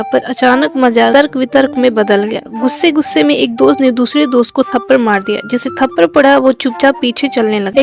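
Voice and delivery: ordinary delivery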